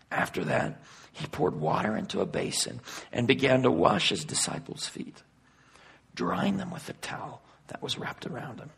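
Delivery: hushed Bible reading, letting each phrase settle; quiet scripture reading. Acted. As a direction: read the verses softly and reverently.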